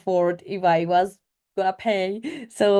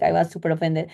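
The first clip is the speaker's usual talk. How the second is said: ordinary talk, nothing marked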